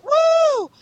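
high-pitched imitated "woo" cheer; crowd cheer. Fan retelling the game launch, cheering. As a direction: whoop high like an excited fan in line.

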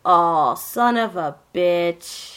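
slow, drawn-out dismay; exasperated curse. Retold or imitated. Friend retelling her reaction to the show, slow drawn-out dismay. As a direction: drag it out in disbelief. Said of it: "you're just like".

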